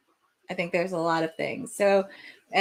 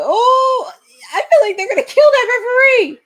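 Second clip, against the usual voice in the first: much higher, louder yell; excited shout; 10 dB louder than usual. Real, not acted. Watching a wrestling match, shouting at a big move.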